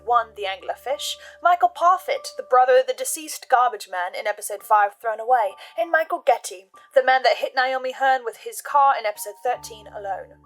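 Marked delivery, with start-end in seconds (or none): none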